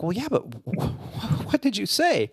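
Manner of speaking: high, stammering insistence; mock bafflement. First officer presses the captain for his secret, baffled stammer. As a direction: stumble into a puzzled, high-pitched question.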